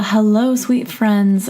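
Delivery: ordinary talk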